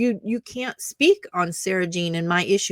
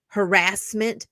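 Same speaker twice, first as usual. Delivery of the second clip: ordinary delivery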